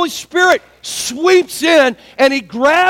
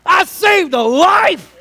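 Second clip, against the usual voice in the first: proud shout; 6 semitones higher, much slower than usual. Retold or imitated. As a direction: crow it out triumphantly. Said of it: "You know, like".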